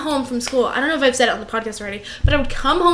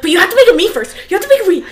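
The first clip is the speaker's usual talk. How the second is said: higher, louder eager insisting; insistent mock excitement; 7 semitones higher, 6 dB louder, faster than usual. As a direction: badger a guest eagerly to make one first. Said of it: "And I'm like".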